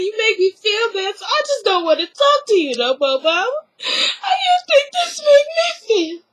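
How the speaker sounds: rising into a high, teary plea; mock tearful. Candy tearfully confronting her mother, imitated high and teary.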